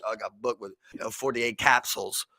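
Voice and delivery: put-on low, soft, offhand bragging kid voice; mock street kid. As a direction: brag about getting booked like it's nothing, low and muted. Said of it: "These people are just like".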